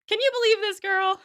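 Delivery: high, loud mock exasperation; exasperated appeal. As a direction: appeal to a bystander in comic disbelief. Acted exasperated appeal, arms flung out.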